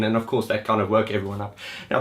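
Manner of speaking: usual speaking voice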